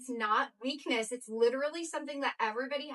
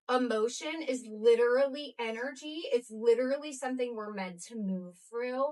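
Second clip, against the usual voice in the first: usual speaking voice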